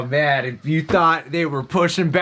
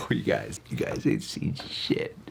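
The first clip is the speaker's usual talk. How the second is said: hushed tease; 13 semitones lower, 10 dB quieter than usual. Acted. Teasing co-hosts about what's coming.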